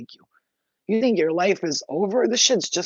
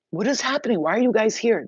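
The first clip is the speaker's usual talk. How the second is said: raised pitch, mild alarm; acted alarmed question; 6 semitones higher, 4 dB louder than usual. Asking humans in the pit why they are there, mildly alarmed. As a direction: question them in mock alarm.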